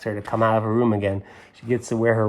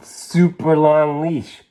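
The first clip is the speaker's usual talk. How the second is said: slow, louder super long leash; drawn out; 5 semitones higher, 7 dB louder, much slower than usual. A narrator's reading. Reader shows the leash picture to the kids, slow and loud. Emphasis while showing picture.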